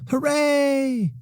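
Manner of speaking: put-on high, delighted little-girl cheer; excited cheer. Girl cheering on her way to school, high and delighted. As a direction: squeal it with joy like a kid racing to school.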